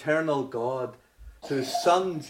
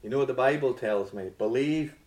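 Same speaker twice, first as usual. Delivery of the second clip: ordinary delivery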